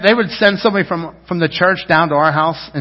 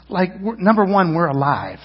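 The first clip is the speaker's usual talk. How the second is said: ordinary delivery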